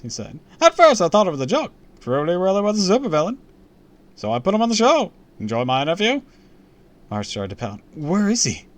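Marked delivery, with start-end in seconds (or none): 0.6-6.2 loud, high showman patter; big projected host voice, pitched up; brash TV host. Acted. Host tells fans about the supervillain guest, loud and showy. 7.9-8.7 urgent high, loud question; crying out. A narrator's reading. Boy asks where the supervillain is, crying out urgently. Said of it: "I cried".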